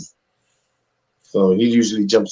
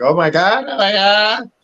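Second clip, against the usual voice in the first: high, squealing mock panic from the agent; hysterical agent; 10 semitones higher than usual. Agent on the phone with big news, high hysterical squeal. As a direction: gush it like a frantic agent. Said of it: "she was all hysterical".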